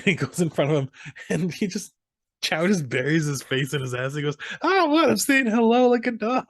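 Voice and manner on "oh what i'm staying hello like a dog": very high, loud cartoonish yelp; mock high squeal. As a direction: squeal it out at the top of the voice. Acting out muffled panicked movie character.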